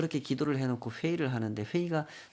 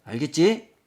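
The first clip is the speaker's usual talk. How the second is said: loud, raised-pitch demand for agreement; stern check; 4 semitones higher, 11 dB louder than usual. Acted. Pastor warning young man not to badmouth the deacon, stern check. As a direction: drive the question home, louder and higher.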